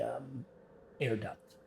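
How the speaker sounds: normal talking voice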